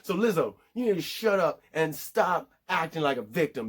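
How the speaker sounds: ordinary talk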